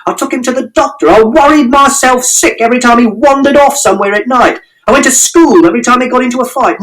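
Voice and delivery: from plain to very high ranting yell; building furious shout. A narrator's reading. Father shouting everything he did for his son. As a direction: list it all, getting angrier. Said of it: "And Father shouted".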